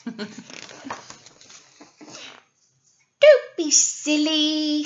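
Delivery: middle-sized goat tells the troll not to be silly. Acted. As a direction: drawl it like a goat brushing off danger. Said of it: "said middle-sized Billy Goat".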